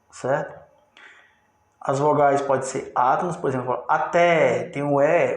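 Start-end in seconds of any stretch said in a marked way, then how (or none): 3.9-4.6 até with a strong, lengthened final E; stressed final E. Shown as a demonstration. Teacher showing the tonic vowel in até, stressed final E. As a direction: lean on the last syllable of até. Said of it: "o E é a tônica".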